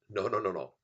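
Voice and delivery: usual speaking voice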